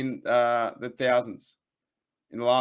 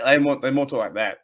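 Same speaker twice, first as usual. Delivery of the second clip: normal talking voice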